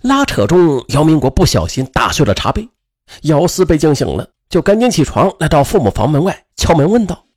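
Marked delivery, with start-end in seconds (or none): none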